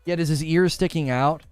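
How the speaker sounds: normal talking voice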